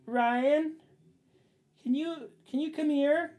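mock sweet call for help; wife calls him from the curtains, high falsetto. Wife calling from other room.

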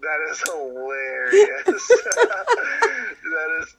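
loud, slow words broken by real laughter; laughing hard. Real, not acted. Co-host reacting to a drunken-purchase confession, laughing loudly. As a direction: crack up and stretch the words through laughs.

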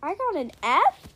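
high, loud disbelief; can't believe the grade; shocked. Real, not acted.

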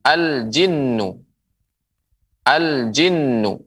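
slow, careful "al-jinnu" with a held nn; slow model reading. Shown as a demonstration. Teacher modelling "al-jinnu" slowly to viewers. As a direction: sound out "al-jinnu" for students. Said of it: "Kemudian huruf jim".

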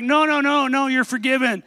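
high, repeated refusals run together; firm insistence. Retold or imitated. Dad waving away his son's offers, insistent.

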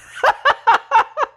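a very high, loud laugh; bashful laugh. Real, not acted. Host admits she is sappy at home, bashful laugh. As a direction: laugh at your own sappiness.

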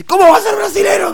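high-pitched mock shout of disbelief; retold indignant yell. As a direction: imitate a friend yelling in outrage. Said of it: "me grita al borde de pegarme casi". (grito indignado imitado, muy agudo)

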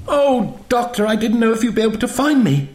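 put-on high, rushed, girlish gush of relief; excited relief. A narrator's reading. Zoe jumps up to the Doctor, excitedly relieved.

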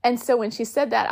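normal talking voice

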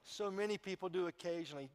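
normal talking voice

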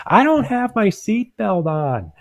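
very high, slow, drawn-out grandma imitation; yelling grandma. Grandma yells back over the car alert, imitated high yell. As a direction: call it out in a mock elderly wail. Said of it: "her yelling".

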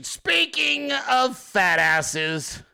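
SPEAKING stretched very long and high; drawn-out segue. Real, not acted. Segue repeated, stretched for effect.

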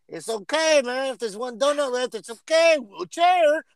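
high, punched-up mock Mexican accent. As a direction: put on a Mexican accent, pitched well up. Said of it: "in his accent".

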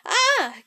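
short high gasp of fright; startled gasp. Acted. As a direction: gasp as if caught off guard. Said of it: "gasped his father".

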